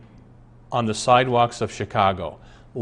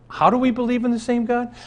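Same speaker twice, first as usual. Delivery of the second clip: rhetorical question; 11 semitones higher, faster than usual. Real, not acted. Rhetorical question raised high.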